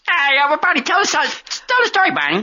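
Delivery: high, loud talk-show host voice; Jay Leno impression. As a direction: hype it up like a late-night host. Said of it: "Who are you, Jay Leno?".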